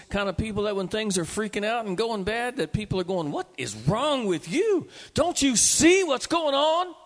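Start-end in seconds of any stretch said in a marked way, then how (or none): 3.3-7.0 higher and louder, slow mock alarm; panicked bystanders. People scold the calm believer, in mock alarm. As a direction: play the worried onlookers.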